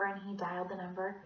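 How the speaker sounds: usual speaking voice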